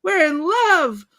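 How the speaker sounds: high, drawn-out mock holler; imitated screaming. Fan retelling Ron announcing love at work, mock screaming. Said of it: "he's screaming all about how they're in love".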